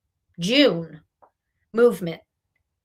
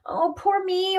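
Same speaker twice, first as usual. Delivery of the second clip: high, soft whiny "poor me"; mock self-pity; 7 semitones higher, 6 dB quieter than usual. Reader mocking a timid client, in a high whiny voice. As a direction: whine it like a sorry-for-yourself client.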